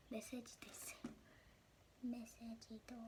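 soft amazed whisper. Real, not acted. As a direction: breathe it out in wonder. Whispering discovery of toy phone.

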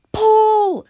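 high, very loud drawn order; commanding shout. Acted. Grasshopper feels tugs on the rope, rallying shout. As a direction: bellow one word to rally everyone. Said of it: "shouted the old green grasshopper".

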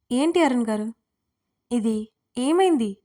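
puzzled, drawing out the last words; confused. A narrator's reading. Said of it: "అయోమయంగా చూసింది".

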